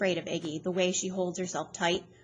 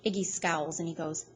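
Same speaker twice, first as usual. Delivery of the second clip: ordinary delivery, nothing marked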